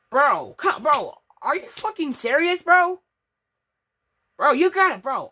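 3 venting at the crashed game; 1 frustrated outburst. Real, not acted. 4 gamer yells at the crashed game, exasperated and loud.

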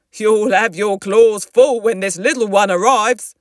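loud storybook dad voice; teasing dad character. Acted. Fred, the father, speaks.

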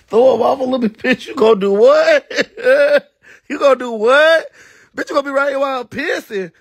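high, loud incredulous retort; shocked, laughing squawk; laughing disbelief. Retold or imitated. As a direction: demand it in a high voice, tickled and scandalized. Reliving shock at his remark.

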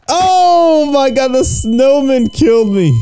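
high, slow, stretched-out disbelief; shocked wail. Real, not acted. Just died in the game.

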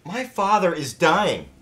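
loud, high, drawn-out cry of protest; anguished outburst. Acted. Son protesting to career-minded partner, anguished outburst. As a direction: throw each word out slowly at full voice.

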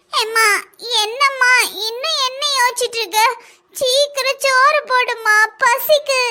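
put-on high, drawn-out child whine; hungry, pleading child. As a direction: pester mum for food like a little kid. Hungry child pesters mother.

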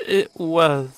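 voice cracking. Acted. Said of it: "with a small crack in his voice".

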